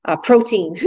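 normal talking voice

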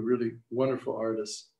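ordinary delivery

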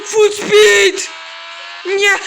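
gasping then a loud high yell; gasp then shout. Real, not acted. Gamer watching Tomas speed into a crash, shouting. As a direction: burst out with a shocked cry.

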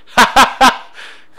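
bursting out laughing, high and loud; big triumphant laugh. Real, not acted. Laughs after the headshot.

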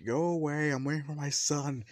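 shouting someone away. Acted. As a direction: send the caller away loudly from behind the door. Mother sends away a knocker.

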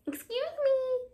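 polite cricket interrupting sad Gerald; little cricket voice. Acted. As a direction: squeak it out like a tiny cricket. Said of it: "coughed a cricket".

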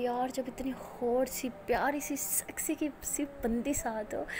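soft, slow, seductive whisper; flirty whisper. Acted. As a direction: breathe it into her ear to tease her. Flirting softly in bed. (धीमी, नशीली फुसफुसाहट)

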